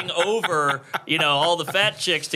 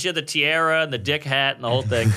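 usual speaking voice

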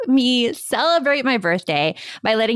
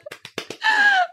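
shrieking laugh. Real, not acted. Birthday girl laughs at gush.

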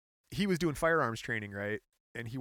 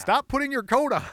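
mock shout; 12 semitones higher, 10 dB louder than usual. Mock scolding co-host leaving.

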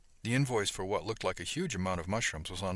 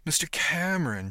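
higher, louder flirtatious coo; 9 semitones higher, 6 dB louder than usual. A narrator's reading. Diane butts in to charm Cameron into a tour, cooing. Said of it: "she cooed into the pickup".

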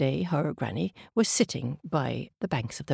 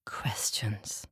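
whispered word; 5 semitones lower, 8 dB quieter, much slower than usual. A narrator's reading. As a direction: whisper questions softly. Soft aside in story.

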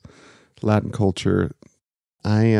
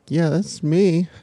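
surprised; 9 semitones higher, slower than usual. Retold or imitated. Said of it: "I was like".

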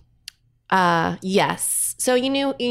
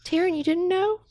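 gentle disbelief; 9 semitones higher than usual. Real, not acted. Breaking news of pet's death.

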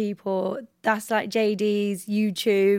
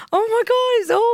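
mock panicked squeal; 11 semitones higher, 4 dB louder than usual. Retells panicking at promo dance.

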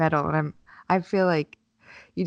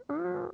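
annoyed high grunt; 12 semitones higher, 9 dB quieter than usual. Retold or imitated. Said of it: "But I'm actually feeling like".

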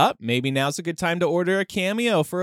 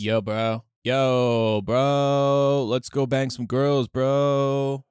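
frat bro; 2 semitones lower, much slower than usual. Pretend. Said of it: "Remember bro Jesus?".

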